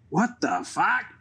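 put-on high, shocked Boston accent outburst. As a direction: yell it in a thick Boston accent. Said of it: "huge Boston accent".

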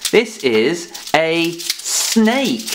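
snake drawn out slowly and clearly; slow word reveal. Shown as a demonstration. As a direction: stretch out snake so the k is heard. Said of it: "what is this?".

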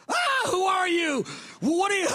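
startled outburst. Retold or imitated. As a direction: recoil as if caught out. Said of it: "she wasn't very happy about that".

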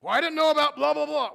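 high, loud whiny complaint; whiny churchgoer. Acted. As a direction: mimic a petulant churchgoer who missed the news.

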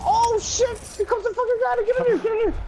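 panicked high yelling; being chased. Real, not acted. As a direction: yell in a frantic panic.

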